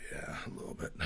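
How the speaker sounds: raspy whispered reply; hoarse whisper. Retold or imitated. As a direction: croak it like a sore throat. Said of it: "I sounded like this".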